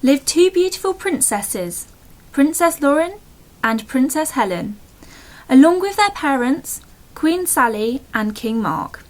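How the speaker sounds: lively storybook reading with playful pauses; bedtime story narration. A narrator's reading. Telling a made-up bedtime story to a loved one, storybook voice. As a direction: read it like a fairy tale at bedtime.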